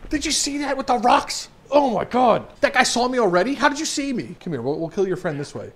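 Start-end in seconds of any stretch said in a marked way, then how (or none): 0.1-4.3 high-pitched disbelieving outburst; startled amazement. Real, not acted. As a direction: react high, astonished at being spotted. Startled by being spotted in game.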